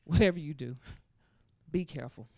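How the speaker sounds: low, soft warning; imitated mom. Mom warns daughter on the phone, low imitated voice. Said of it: "She's like".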